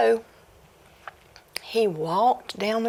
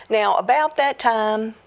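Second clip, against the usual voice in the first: ordinary delivery, nothing marked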